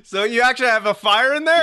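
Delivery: high, loud, amazed question; laughing shock at the news; surprised. Real, not acted. Host reacts to real fire.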